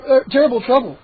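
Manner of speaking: usual speaking voice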